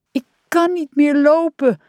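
high, plaintive whine; wailing. A narrator's reading. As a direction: wail it out in a high voice. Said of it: "jammerde hij".

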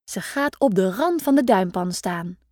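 ordinary delivery, nothing marked

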